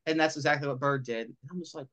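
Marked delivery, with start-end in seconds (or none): none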